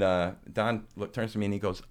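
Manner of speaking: normal talking voice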